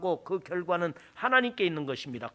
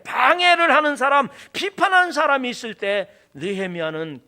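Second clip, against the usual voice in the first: loud, raised sermon delivery; full-force preaching; 8 semitones higher, 9 dB louder than usual. Real, not acted. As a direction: preach it with force, pitched high.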